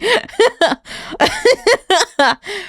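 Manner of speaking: long, high giggling fit; helpless laughter. Real, not acted. Streamer itchy from annoyance, helpless giggling.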